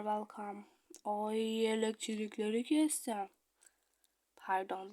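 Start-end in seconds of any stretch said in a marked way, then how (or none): none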